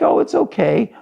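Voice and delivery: high-pitched, indignant mimicry; acted upset son parroting his father; mocking reassurance. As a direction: throw the comforting words back in protest. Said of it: "you're just acting like".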